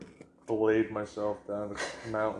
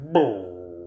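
loud booming belly noise; imitated belly noise; 3 semitones lower, 8 dB louder than usual. Telling a partner what the belly did, loud imitated boom. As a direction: boom it out like a rumbling stomach. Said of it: "My belly was just like".

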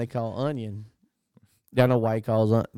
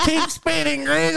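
mock cheer; 13 semitones higher than usual. Mock cheering the stuck car.

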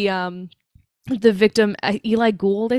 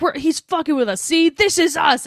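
higher-pitched grumpy complaint, dragging at the end; mock tantrum; 6 semitones higher than usual. Imitating grumpy detective complaining to his partner, mock tantrum. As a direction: imitate someone throwing a tantrum. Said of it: "throwing a tantrum, like, being grumpy".

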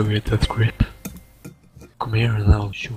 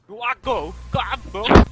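very high fake British squawk; hammed-up British dare, building to a yell; mock British screaming; 11 semitones higher, 4 dB louder than usual. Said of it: "British and screaming".

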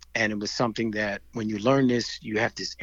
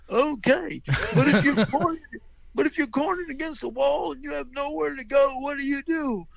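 sing-song Southern instructor voice, pitched up; Southern accent; 12 semitones higher than usual. Retold or imitated. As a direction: imitate a Southern karate teacher calling to kids. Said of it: "'Cause he had a Southern accent".

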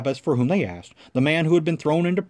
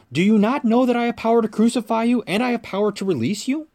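higher-pitched, pressing question; indignant challenge; 8 semitones higher than usual. A narrator's reading. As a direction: challenge the silent prisoner, voice raised in pitch. Pilate challenges the silent Jesus.

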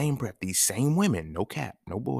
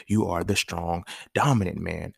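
normal talking voice

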